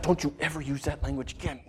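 very soft, low scolding, imitated; quiet stern rebuke. Wife answering her raging husband, quiet stern rebuke. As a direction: drop low and admonish softly.

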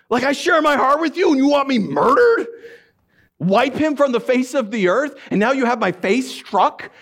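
high-pitched indignant protest; Paul. Retold or imitated. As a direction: demand an answer in wounded disbelief. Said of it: "that's what Paul was like".

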